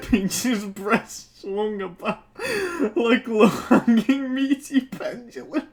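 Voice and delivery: high, loud wheezing laughter through the words; real laughing fit; helpless laughter. Real, not acted. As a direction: barely get the words out. Collapsing into laughter.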